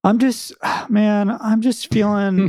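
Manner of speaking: put-on sulky, mumbling teenage brother; mopey Jake voice. Podcast intro imitating Jake.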